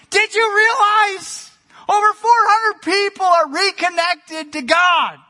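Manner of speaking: squealing-high, loud imitation of overjoyed excitement; [name]. Imitating a pastor thrilled about reconnected people, high and exuberant. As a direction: pitch it way up, voice nearly cracking like puberty. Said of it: "my voice goes into puberty when I talk like that".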